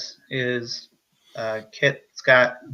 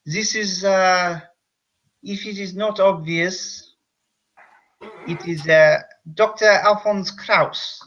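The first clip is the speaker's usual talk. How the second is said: higher, slow mock accent that drifts French; a hammy foreign doctor introducing himself; muddled German accent; slipping French-German accent; 4 semitones higher than usual. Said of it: "this is a French accent".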